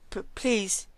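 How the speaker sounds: high stuttered plea; stammering yelled plea. A narrator's reading. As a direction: stammer out a desperate please. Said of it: "she yelled".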